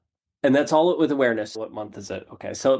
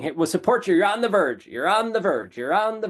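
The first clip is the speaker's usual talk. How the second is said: lightly voiced universe, cheerfully repeating; mocking universe voice; 7 semitones higher than usual. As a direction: tease like a voice that never delivers.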